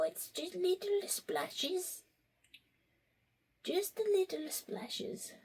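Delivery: little squeaky sprite voice; tiny high voice. Pretend. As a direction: shrink into a tiny fairy voice. Tiny high whispery character voice.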